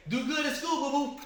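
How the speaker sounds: high, loud sing-out send-off; doting aunt. Retold or imitated. Aunt hugs nephew goodbye at bus stop, high doting call. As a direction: imitate an auntie fussing over a child. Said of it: "and you was like".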